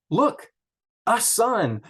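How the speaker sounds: high, bright announcement of a newborn son; excited cry. Shown as a demonstration. Teacher voicing Leah's joy at a son, high and excited. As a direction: exclaim it like a proud new mother.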